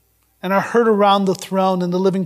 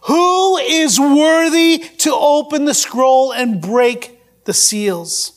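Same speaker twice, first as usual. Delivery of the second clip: raised, slow proclaiming voice; higher, measured scriptural proclamation; loud angelic proclamation; 3 semitones higher, much slower than usual. Acted. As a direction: proclaim it like a mighty angel, drawn out. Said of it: "proclaiming with a loud voice".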